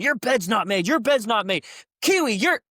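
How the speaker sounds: high, loud drill-instructor scolding; mock inspection yell. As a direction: go down the line barking at beds. Instructor inspecting beds yelling.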